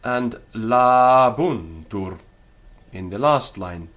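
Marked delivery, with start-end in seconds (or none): 0.5-2.2 "labuntur" slow and loud, la and bun held long; long vowel. Shown as a demonstration. Teacher showing the same rule, "labuntur" with long a. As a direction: stretch the first two syllables of "labuntur". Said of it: "same applies there".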